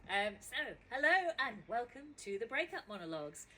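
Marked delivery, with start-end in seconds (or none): none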